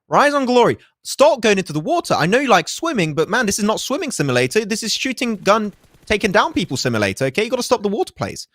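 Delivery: high-pitched exasperated scolding; mock lashing out. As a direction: tell off the team in playful exasperation. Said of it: "I'm gonna lash out".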